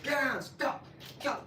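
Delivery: high urgent yell of get down, stop; shouting at dog. Retold or imitated. Retelling yelling at the pit bull to stop. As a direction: snap the commands at the dog.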